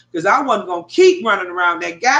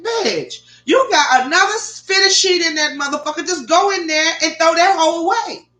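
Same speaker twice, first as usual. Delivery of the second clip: high, fed-up scolding of herself; raised, irritated order to just throw it out; exasperated self-talk; 8 semitones higher, 3 dB louder than usual. Retold or imitated. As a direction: tell yourself off, at the end of your rope. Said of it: "I said".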